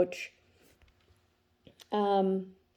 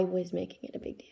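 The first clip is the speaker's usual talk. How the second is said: whisper; 8 dB quieter, faster than usual. Real, not acted. Confessing the moral of story.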